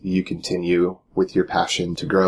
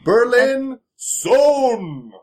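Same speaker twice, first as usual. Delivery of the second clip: high, hugely drawn-out name reveal; winner announcement; 4 dB louder, much slower than usual. Real, not acted. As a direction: announce it like a prize-fight introduction. Announcing the full scholarship winner.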